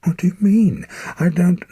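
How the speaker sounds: high, loud startled question; alarmed cry. Acted. Alarmed boy interrupts his dying father, high and loud. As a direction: blurt it out in alarm.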